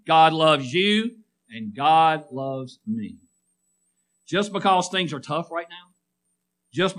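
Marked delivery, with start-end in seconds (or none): 0.1-3.2 slow, deliberate words for the church to repeat; slow recitation. Shown as a demonstration. Preacher leading the congregation in unison, slow. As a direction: lead them word by word. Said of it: "Say that with me".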